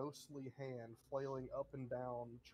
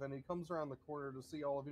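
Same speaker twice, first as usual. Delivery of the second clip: usual speaking voice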